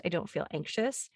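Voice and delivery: ordinary delivery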